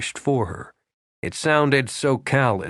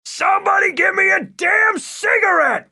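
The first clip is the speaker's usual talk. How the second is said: loud, high, furious yell for a cigarette; shouting; 5 dB louder than usual. A narrator's reading. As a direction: bellow it at the whole room. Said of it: "he shouted".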